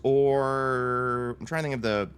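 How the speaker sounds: slow words between blowing on soup; talking while cooling soup; blowing on hot food. Real, not acted. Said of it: "Blowing on my, my soup".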